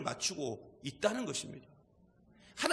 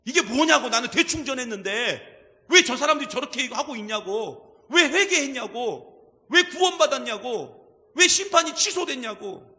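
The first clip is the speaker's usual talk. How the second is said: much louder, higher, indignant shouting; angry complaint; 3 semitones higher, 8 dB louder than usual. Retold or imitated. Jonah shouting at God over the pardon, acted anger. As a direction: hammer each 왜 in outrage. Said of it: "요나가 지 뜻대로 안 됐다고".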